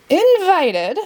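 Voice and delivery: high, indignant shriek; shrieked outrage. A narrator's reading. The Queen hears Alice was not invited, shrieking. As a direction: squeal it in disbelief. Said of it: "shrieked the Queen".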